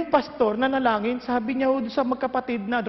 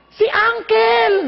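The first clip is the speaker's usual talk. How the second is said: drawn-out, loud, higher cry of recognition; excited exclamation; 7 semitones higher, 6 dB louder, much slower than usual. Retold or imitated. Friend in LA cries out, recognising his uncle in the story, excited. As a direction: shout it out, thrilled to know him.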